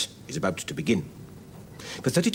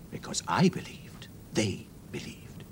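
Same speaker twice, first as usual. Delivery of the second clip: whisper; 2 semitones lower, 7 dB quieter than usual. Shown as a demonstration. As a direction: lean in and whisper it. Repeats the line in a whisper.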